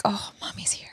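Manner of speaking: soft mock-seductive whisper; whispered aside. Reacting to his mixed-up English, playful whisper.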